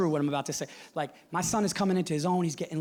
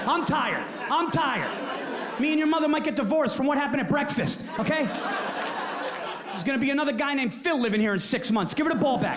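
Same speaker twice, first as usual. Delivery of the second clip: exasperated rant; 7 semitones higher, 3 dB louder than usual. Retold or imitated. Dad ranting back at son.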